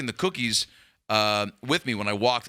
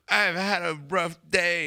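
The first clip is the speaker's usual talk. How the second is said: fake crying; 5 semitones higher, slower than usual. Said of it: "trying to, like, manufacture tears".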